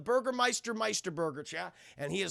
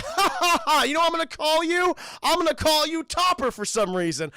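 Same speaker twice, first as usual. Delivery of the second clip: loud, high, hearty laughing delight; jolly Kris Kringle; 8 semitones higher, 8 dB louder than usual. Retold or imitated. Kris Kringle naming his new penguin friend, jolly booming voice. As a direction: boom it out high with a big ha-ha.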